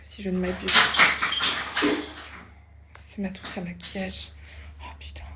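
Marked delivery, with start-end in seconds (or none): none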